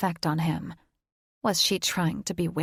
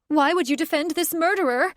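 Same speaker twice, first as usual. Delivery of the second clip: much higher, louder birdlike shriek; shrieking demand; 10 semitones higher, 5 dB louder than usual. Acted. Catherine shrieks at Thaddeus, high and wild. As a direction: screech the question at him.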